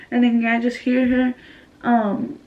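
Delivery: ordinary talk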